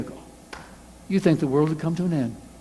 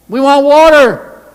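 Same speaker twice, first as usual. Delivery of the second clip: high, loud, slow crowd cry; loud demand; 9 semitones higher, 12 dB louder, slower than usual. Acted. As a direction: shout for water as the crowd.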